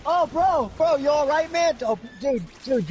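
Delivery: high-pitched imitation of a bystander; concerned bro. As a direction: play an alarmed bro finding his buddy. Passerby checking on knocked-out man.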